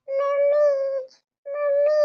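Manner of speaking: high wailing call for mummy; crying child. Acted. Fallen child wailing for mummy, crying. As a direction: cry out high, drawing out the word. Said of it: "ಅಳ್ಬೇಡ".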